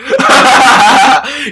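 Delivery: high loud giggling burst; high giggling fit; burst of laughter. Real, not acted. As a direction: crack up loudly. Laughing at own joke.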